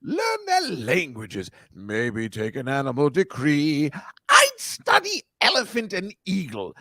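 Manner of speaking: lightly hammed talk-singing in a Rex Harrison manner; half-speak, half-sing it like a stage musical. Host imitating Doctor Dolittle song, light talk-singing. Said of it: "That's Rex Harrison".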